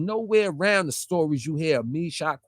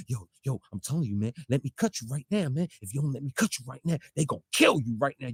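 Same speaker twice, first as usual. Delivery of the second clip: low, hushed imitation of urging; quiet, hurried mock warning; mock urgent plea; 4 semitones lower, 6 dB quieter, faster than usual. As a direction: mimic someone pleading under their breath. Mimics opponent's words mid-fight.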